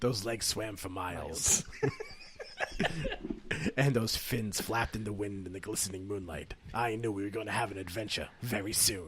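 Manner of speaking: low, soft hard-boiled narration; noir detective. Acted. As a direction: brood like a noir gumshoe. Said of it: "the noir?".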